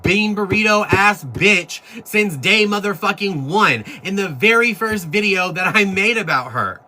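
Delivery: pitched well up and building, an indignant tirade; heated rant. Real, not acted. Ranting at her commenters, high and indignant. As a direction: let the outrage climb line by line.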